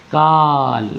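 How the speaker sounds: काल with the आ held very long; prolated vowel. Shown as a demonstration. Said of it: "नाटक वाले के हिसाब से".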